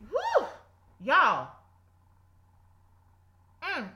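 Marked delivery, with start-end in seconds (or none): 0.1-0.5 short, high, loud "woo"; high whoop. Real, not acted. Preacher overcome after delivering the Lord's message, high whoop.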